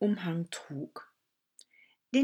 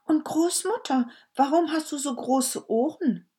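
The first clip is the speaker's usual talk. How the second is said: put-on much higher, louder wondering child; amazed little girl asking; 8 semitones higher, 5 dB louder than usual. Girl voiced in story.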